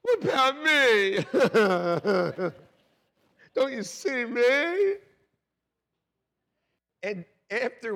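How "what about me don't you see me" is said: high, loud, slow mock whine; a hammed-up, self-pitying plea; whining to God. Preacher imitating his old self whining to God, self-pitying. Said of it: "I was like".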